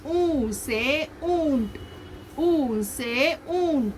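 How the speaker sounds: slow, with "Oo" and "oont" stretched; drawn-out vowels on "Oo" and "oont"; long oo sound. Shown as a demonstration. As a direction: hold the oo in "Oo" and "oont". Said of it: "you make a longer sound".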